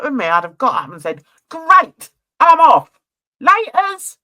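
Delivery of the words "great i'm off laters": loud, high, punchy send-off; breezy exit. Pretend. Vlogger imagining herself walking out on him, loud breezy exit. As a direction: call it out brightly as a mock parting shot.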